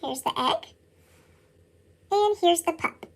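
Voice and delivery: plain reading voice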